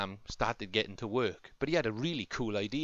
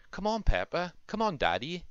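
higher, lightly called-out urging; mild storybook shout; 8 semitones higher than usual. A narrator's reading. Fireman Sam calls Peppa and Daddy into the sea, mildly shouting. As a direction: call them over without really yelling. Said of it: "shouted Fireman Sam".

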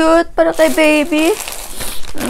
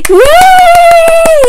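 high, loud squeal of delight; excited squeal; 7 dB louder than usual. Real, not acted. Expecting mom squeals over baby clothes, excited. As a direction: squeal with joy.

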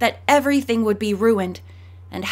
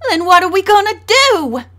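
higher, louder tearful cry; near tears; 9 semitones higher, 7 dB louder than usual. A narrator's reading. Daughter pleading with her father, near tears and louder. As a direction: cry it out, about to break down. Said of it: "cried his daughter, who was on the verge of tears".

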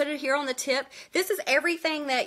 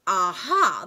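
aha; 3 semitones lower, 6 dB louder than usual. Retold or imitated. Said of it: "I was like".

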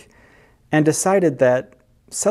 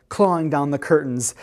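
usual speaking voice

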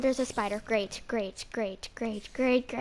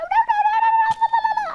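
much higher, louder, frantic no's; panicked screaming; 12 dB louder than usual. Real, not acted. Under arrow fire, a frantic high shriek.

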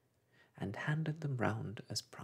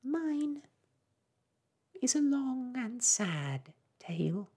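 high, loud mournful mouse voice; sighing sadly; 14 semitones higher, 5 dB louder, slower than usual. Acted. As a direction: lament like a tiny mouse. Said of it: "turning to Alice and sighing".

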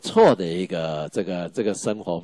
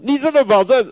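put-on much higher and louder, insistent girl's voice; student pressing the teacher for a promise, high and loud; pleading student; 8 dB louder than usual. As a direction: squeak it out, demanding a promise. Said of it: "我说：".

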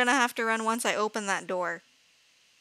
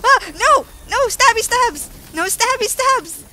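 high, loud panicked shrieking; frantic squeal of real fright; terrified; 11 semitones higher, 9 dB louder than usual. Real, not acted. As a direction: scream it as if under attack. Startled by attacker in game.

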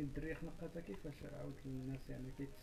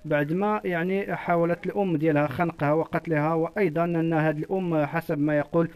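measured reporter's piece to camera; news report; 22 dB louder, slower than usual. Real, not acted. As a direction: report it steadily like a TV correspondent.

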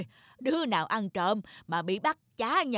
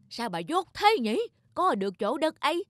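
scolding wife; 6 semitones higher, 4 dB louder than usual. Acted. Husband scolds wife over land.

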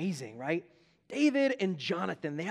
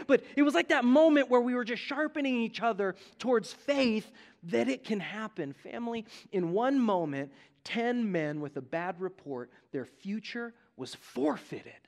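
ordinary talk

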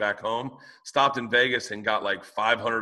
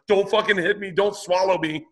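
high, loud frightened yelp; panicked plea; 8 semitones higher, 4 dB louder than usual. Retold or imitated. Diver pleading with a rising whale, high mock panic.

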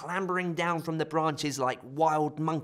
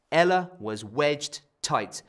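plain reading voice

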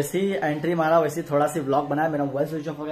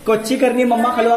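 imitating the child; 6 semitones higher, 5 dB louder than usual. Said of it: "का मतलब क्या है?".

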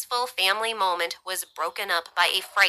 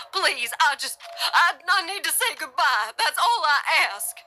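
high, tearful pleading; voice breaking, stammering; 9 semitones higher than usual. A narrator's reading. Pinned man begging to say goodbye, voice breaking. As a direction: plead haltingly, voice cracking with emotion. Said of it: "his voice breaking with emotion".

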